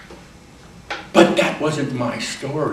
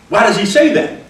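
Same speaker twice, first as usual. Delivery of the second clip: ordinary talk, nothing marked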